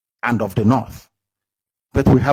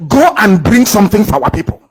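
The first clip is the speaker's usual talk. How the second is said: raised, louder voice giving an order; retold command; 7 semitones higher, 6 dB louder than usual. Speaker retelling his own order to his people, raised and commanding. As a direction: call out the order to go and fetch help. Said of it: "I said".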